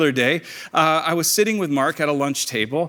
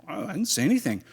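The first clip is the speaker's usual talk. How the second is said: softer, higher mock-timid protest; meek denial; 4 semitones higher, 6 dB quieter than usual. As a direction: act out backing down from a bully. Retold timid denial to bully.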